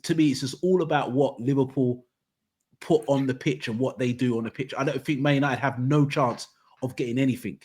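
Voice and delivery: normal talking voice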